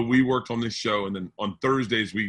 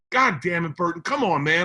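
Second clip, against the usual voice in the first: mock-exasperated plea, pitched up; exasperated pleading; 5 semitones higher than usual. [name] pressing Burton who won't go, exasperated pleading. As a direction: lean on him after he refuses. Friend pressing after a refusal.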